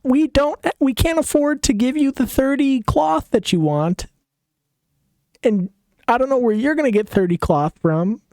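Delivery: high whiny complaining voice; nagging wife. Acted. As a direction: pester and whine at the husband. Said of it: "And just nags".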